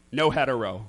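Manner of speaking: low, soft throwaway; deadpan disclaimer. Acted. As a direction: drop it in low and quiet after the pitch. Deadpan tag on acted proposal.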